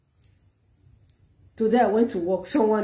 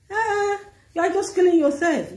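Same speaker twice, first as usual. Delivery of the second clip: scolding; 11 semitones higher, 3 dB louder than usual. Acted. Scolding a worried wife.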